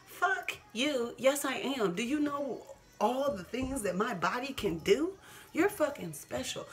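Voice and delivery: defiant comeback to people who say she's not special; defiant retort. Real, not acted. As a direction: talk back to the doubters.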